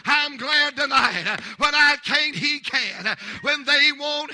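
loud, rising sermon cadence; preaching at full force. Real, not acted. Preacher to church, loud fervent preaching. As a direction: preach it out to the congregation.

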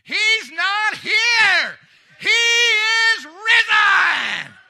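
very high, full-throated drawn-out shout; thunderous resurrection proclamation; angel's triumphant shout. Acted. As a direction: cry it out at the top of your lungs, stretching every word. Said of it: "shouted out to those who came to that tomb".

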